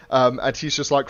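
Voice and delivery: ordinary delivery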